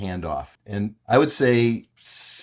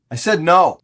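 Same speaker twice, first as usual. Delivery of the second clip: raised, emphatic quote of an annoyed man; higher, louder mock protest; retold indignant refusal; 8 semitones higher, 8 dB louder than usual. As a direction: say it loud and firm, retelling a standoff. Retold office confrontation, angry occupant.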